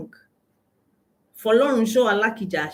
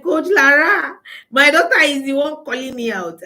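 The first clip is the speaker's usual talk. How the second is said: higher, louder mock outcry; exasperated cry; 7 semitones higher, 6 dB louder than usual. Mother complaining to Coach Lara about her daughter, loud mock outcry. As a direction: cry out in comic disbelief. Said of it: "She was like".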